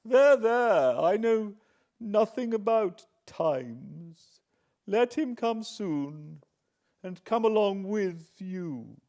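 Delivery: put-on high, loud, impatient old woman; high fretful old lady. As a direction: brush him off, fretful and shrill. Miss Havisham impatient.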